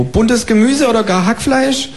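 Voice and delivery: high, chirpy kangaroo asking for eggs. Acted. Kangaroo asking the neighbour for eggs, high and chirpy. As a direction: pipe up like a polite cheeky kangaroo.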